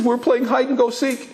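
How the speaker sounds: put-on high-pitched tearful child's whine; crying little boy. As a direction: imitate a little boy wailing his complaint. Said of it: "what the boy was crying about".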